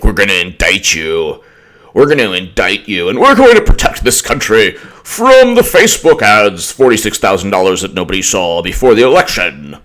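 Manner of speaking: mock a pompous prosecutor declaring war; put-on bombastic official voice; Mueller. Mocking Mueller's grand pronouncements.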